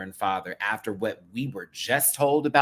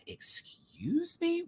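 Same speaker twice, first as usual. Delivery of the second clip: very high, slow drawn-out disbelief; high drawn-out sass; incredulous; 6 dB quieter, much slower than usual. Real, not acted. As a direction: stretch it out in mock outrage. Incredulous reaction.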